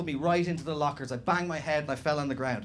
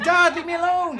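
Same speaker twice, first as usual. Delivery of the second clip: high, louder mock whine; whiny protest; 13 semitones higher, 8 dB louder than usual. Teen son telling his dad to leave him alone, mock whine. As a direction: whine back like an annoyed teenager.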